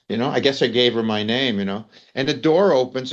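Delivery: ordinary delivery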